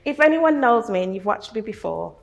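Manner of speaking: fighting to keep the tears in; tearful. Real, not acted. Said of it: "and I'm getting tearful now".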